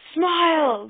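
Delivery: loud, high call; shouted summons over the shoulder. A narrator's reading. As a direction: call the dog from across the way. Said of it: "called over his shoulder".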